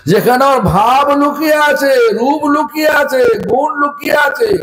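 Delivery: fervent preaching climax; forceful preaching. Real, not acted. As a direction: drive the point home to the crowd. Preacher rising to emphasis.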